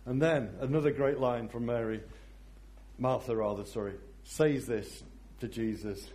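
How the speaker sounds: ordinary delivery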